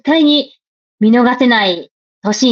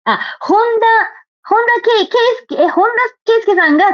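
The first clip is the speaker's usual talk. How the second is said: much higher, startled name recall; a sudden jump up in surprise; surprised; 10 semitones higher than usual. Real, not acted.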